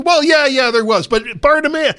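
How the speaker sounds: high, loud acted townsperson; excited brushing-off. Townsperson answers about another healed man, excitedly dismissive. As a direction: wave the point away in an excited high voice. Acts out excited reply to gossip.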